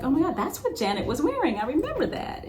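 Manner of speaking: high, quick gasp of recognition, half-acted; excited recognition. Recalling spotting [name]'s tour outfit in old footage, excited high retold exclamation. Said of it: "and I was like".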